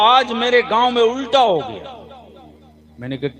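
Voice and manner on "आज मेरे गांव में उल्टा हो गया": high loud Bengali dada; exclaiming in Bengali-accented Hindi. Retold or imitated. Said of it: "Bengali दादा".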